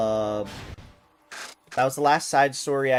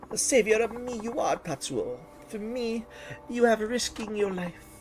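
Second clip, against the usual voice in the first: higher, hammed-up broken-English foreign accent; a foreign diva's shaky fake accent, higher pitched; questionable foreign accent; 4 semitones higher than usual. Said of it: "my questionable accent of the day".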